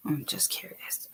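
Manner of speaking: tiny whispered aside. Real, not acted. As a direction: whisper it under your breath.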